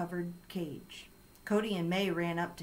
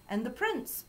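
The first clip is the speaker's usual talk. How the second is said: higher, rising note of surprise; mildly surprised announcement, pitched up; 6 semitones higher than usual. A narrator's reading.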